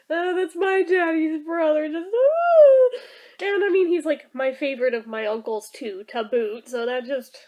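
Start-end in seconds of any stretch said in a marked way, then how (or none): 0.1-3.0 higher tearful wail, ending in a loud high "oh"; mock wailing. Reacting to daddy's speech, hammed-up tearful wail. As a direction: imitate being overcome with tears, building to a wail. Imitating own tears at dad's speech.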